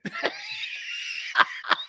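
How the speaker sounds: shrieking high laugh; high laughing fit. Real, not acted. Host bursts out laughing at his story, high shriek.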